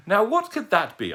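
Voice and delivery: put-on a dotty, courteous old lady; polite old lady. Old lady greeting the owl who fell at her feet, genteel and high.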